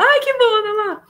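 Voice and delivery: high, loud sneering villain; Snoke voice. Retold or imitated. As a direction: squeak it out like a smug villain. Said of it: "ele fala".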